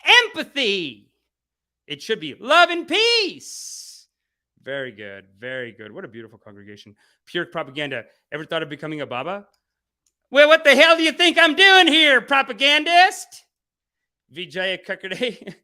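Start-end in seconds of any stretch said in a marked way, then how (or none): 0.0-4.1 slow, much higher preachy reading; drawn-out saintly proclaiming; Adama's chat message. Retold or imitated. Reading Adama's chat line, slow and high. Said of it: "Adama says". 10.3-13.4 much higher and louder retort; hammed-up indignant comeback; mock outrage. Real, not acted. Answering a troll in chat, loud and high.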